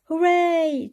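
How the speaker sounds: high, loud happy cheer. A narrator's reading. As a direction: shout it with joy. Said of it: "cheered Peppa and her friends".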